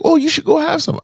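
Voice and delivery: breezy high mother, urging; high mom voice. Retold or imitated. Mom urging her son to have kids, high voice. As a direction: float it up high like Mom suggesting it lightly.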